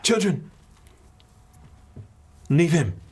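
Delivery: put-on high, commanding woman scolding children; woman's voice. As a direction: call the children off firmly in an accented woman's voice. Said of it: "woman's voice".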